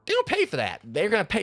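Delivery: outraged yelp. Real, not acted. Outraged at helmet hit.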